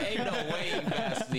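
laughing through "ain't no way"; giggling disbelief, high. Real, not acted. Laughing off a friend's claim he passed him on the highway, laughing.

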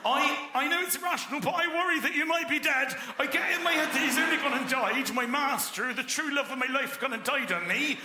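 a devoted dog fretting in a high Norfolk voice; high worried Norfolk accent dog. Acted. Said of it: "give him a Norfolk accent".

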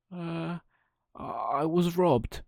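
hesitant, slow, high lie; a guilty puppet stalling; nervous lie. Acted. Pinocchio lying to the fairy, slow and hesitant. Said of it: "said Pinocchio".

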